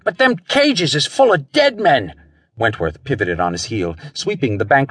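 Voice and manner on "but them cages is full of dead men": high, agitated guard's voice; panted out. A narrator's reading. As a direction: blurt it out high, lightly winded. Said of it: "he panted out".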